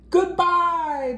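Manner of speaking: high called-out goodbye. A narrator's reading. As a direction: call it out as kids rush off. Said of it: "the students called out".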